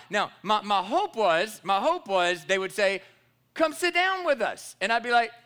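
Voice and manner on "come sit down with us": imitating friendly invite. Hoped-for invitation from strangers.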